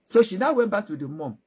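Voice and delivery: plain reading voice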